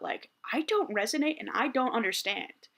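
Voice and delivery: usual speaking voice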